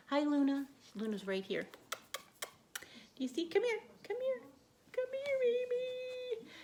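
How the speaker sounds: very high pet-calling voice. Real, not acted. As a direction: coax the dog over sweetly.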